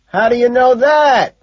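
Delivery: high, loud yell; full-volume holler; shouting. A narrator's reading. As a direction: shout the question over the noise. Shouted exchange at a concert.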